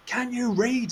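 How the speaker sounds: high, scornful mock question; mock angry demand. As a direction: sneer it like the rude man. Retold angry man again.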